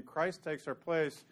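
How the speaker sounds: ordinary delivery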